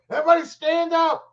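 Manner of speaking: high, strained mock yell; screaming dad. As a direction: holler at the kids like a frantic dad. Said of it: "it's just screaming at his kids".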